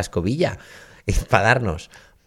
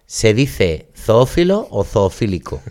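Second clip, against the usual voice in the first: slow, careful question to a phone; slow for Siri; 3 dB louder, much slower than usual. Shown as a demonstration. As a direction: spell it out slowly for the voice assistant. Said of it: "Oye, Siri". (pregunta lenta al asistente)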